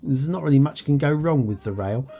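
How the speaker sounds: ordinary talk, nothing marked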